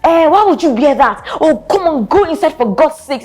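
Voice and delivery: put-on high, loud scornful boyfriend voice; mock public put-down, high and loud; mock rude boyfriend. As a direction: snap at her like a showy boyfriend in public. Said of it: "he just goes all".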